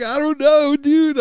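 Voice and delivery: very high comic bewilderment; flustered protest. Retold or imitated. Host defends himself to assistants, mock-flustered protest. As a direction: plead innocence in a mock-panicked squeal.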